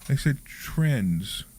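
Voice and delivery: trends, drawn out slow and soft; trends said slowly and carefully. Shown as a demonstration. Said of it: "No, it was trends".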